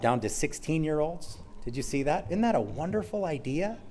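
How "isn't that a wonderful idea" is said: sarcastic mock approval, pitch lifted, softer. Real, not acted. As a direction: feign delight at a terrible idea, quietly. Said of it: "I'm being sarcastic".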